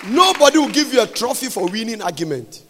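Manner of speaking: loud, emphatic pulpit delivery; preaching. Real, not acted. Preacher warning couples about winning arguments, loud preaching. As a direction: drive it home to the congregation.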